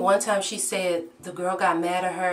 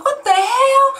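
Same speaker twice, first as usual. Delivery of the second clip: much higher and louder disbelieving cry; shocked outburst; 10 dB louder, slower than usual. Retold or imitated. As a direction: blurt it in total shock. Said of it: "I'm like".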